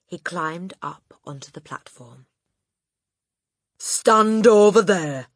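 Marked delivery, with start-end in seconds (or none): 3.8-5.3 loud, slow command; ordered. Acted. Headmistress ordering the boy aside. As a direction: bark it like a drill sergeant. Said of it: "the Trunchbull ordered".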